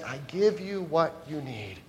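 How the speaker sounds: soft, tender, hushed; a lightly voiced, quiet Jesus; gentle voice of Jesus. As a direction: speak as Jesus offering himself, softly. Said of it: "And Jesus says".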